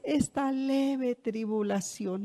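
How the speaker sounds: slow, drawn-out scripture quote; quoting the apostle. Retold or imitated. Preacher quoting the apostle to the church, slow and drawn-out. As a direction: stretch each word out slowly. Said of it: "al final dice".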